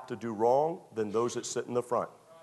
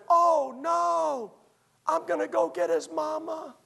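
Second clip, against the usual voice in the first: much higher, mock-panicked friend; 13 semitones higher, slower than usual. Friend at the window panicking over the fallen man, squealing high.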